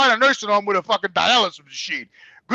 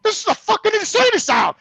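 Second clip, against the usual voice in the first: appalled disbelief; 11 semitones higher than usual. Retold or imitated. Appalled at the nursing home.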